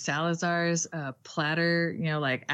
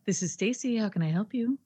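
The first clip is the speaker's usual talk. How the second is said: higher, softly professional phone greeting; polite, pitched-up customer-service voice; 4 semitones higher, 3 dB quieter than usual. Shown as a demonstration. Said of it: "telephone, uh, speaking to customers voice".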